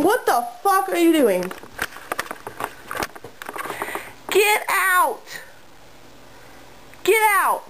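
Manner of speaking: sharp high shout at the cat; startled yell. Real, not acted. Yelling at the cat.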